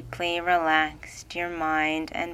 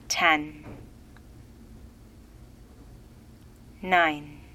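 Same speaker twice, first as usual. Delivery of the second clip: rousing countdown; 4 dB quieter than usual. Acted. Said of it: "I'll soon count backward from ten".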